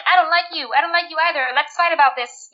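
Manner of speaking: high-pitched mock squabble; mock bickering. Mimicking TV characters squabbling for humor, mock bickering.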